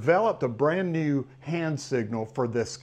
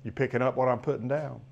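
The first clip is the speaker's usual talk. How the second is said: ordinary delivery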